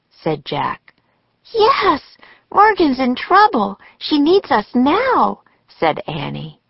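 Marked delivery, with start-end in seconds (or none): 1.5-5.4 high-pitched, pressing plea; urgent insistence. A narrator's reading. Annie telling Jack that Morgan needs help, urgent insistence. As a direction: voice an impatient girl, pitched high.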